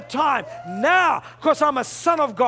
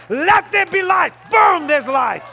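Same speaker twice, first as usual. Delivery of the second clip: loud, raised proclamation; shouted divine command; 4 semitones higher, 5 dB louder than usual. Acted. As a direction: command it, then punch "Boom". Preacher voices God's command.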